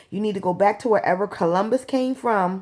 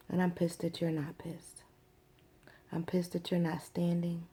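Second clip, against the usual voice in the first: voiceless, intense whisper of frustration; whispered anger; 12 dB quieter than usual. Real, not acted. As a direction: keep it hushed but seething. Whispered message to her.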